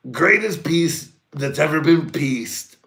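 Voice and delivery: high, loud, slow boasting voice; mock Trump. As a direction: stretch the brag out grandly. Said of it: "I was like".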